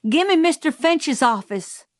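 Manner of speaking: high-pitched urgent call, mild shout. A narrator's reading. Calpurnia demanding the office by telephone, mildly shouted. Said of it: "and shouted".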